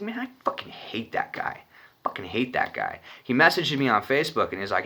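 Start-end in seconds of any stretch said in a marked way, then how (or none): 0.0-3.0 whispered under the breath; whispered grudge. Retold or imitated. Muttering to himself about the guy he hates, whispered. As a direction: hiss it privately.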